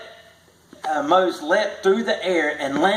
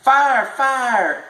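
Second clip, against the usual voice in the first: high alarm cry; fire bell call; 9 semitones higher, 4 dB louder than usual. A narrator's reading. Fire bell sounding at night, high ringing call. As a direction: ring it out like an alarm. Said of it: "the fire bell rang".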